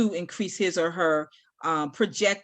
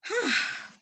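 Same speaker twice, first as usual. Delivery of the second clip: overwhelmed sigh; 12 semitones higher, 4 dB quieter than usual. Real, not acted. Overwhelmed by big question.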